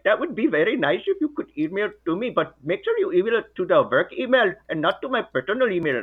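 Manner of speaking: put-on high, fast Indian accent; comic, sing-song Indian-accented voice; generic Indian accent. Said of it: "very good generic Indian accent".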